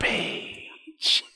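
breathy whispered "Front page"; whispered catchphrase. Shown as a demonstration. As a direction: hush it out low. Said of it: "her signature phrase".

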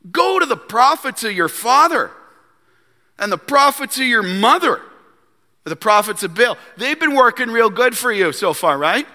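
high-pitched, taunting mock advice; sarcastic. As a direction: needle the king with fake encouragement. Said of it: "he's being sarcastic".

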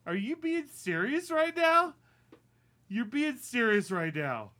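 high, loud disbelief; exasperated. Acted. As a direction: rail at him in exasperation. Exasperated rant.

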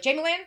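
"Jamie Lynn" as one word, Jamalynn; run-together name. Retold or imitated. Grandfather calling her, "Jamie Lynn" run together as one word. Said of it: "All one word, you mean?".

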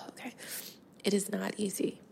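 holding back tears, with long halting pauses; getting teary-eyed. Real, not acted. Podcaster mourning her soulmate cat, close to tears. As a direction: pause and sigh to keep composure. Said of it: "I'm gonna get teary-eyed here".

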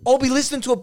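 ordinary delivery

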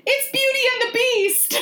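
very high mock squeal; Nicole. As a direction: imitate a giddy, high-pitched exclamation. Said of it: "Nicole went".

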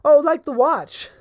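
put-on high, sweet old shopkeeper lady; a chirpy old woman at her counter. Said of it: "old human lady".

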